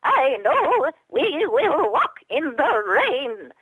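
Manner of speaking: high, slow squeaky puppet mom; tiny high-pitched puppet voice, slow; Twiddlebug mom. Retold or imitated. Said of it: "the Twiddlebug mom from the Muppets".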